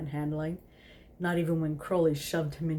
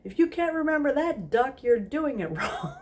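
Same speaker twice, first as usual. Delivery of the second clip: high loud teasing call with a laugh; madam's raucous reply; yelled back; 9 semitones higher, 4 dB louder than usual. A narrator's reading. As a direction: shout back, laughing, much higher. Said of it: "yelled back".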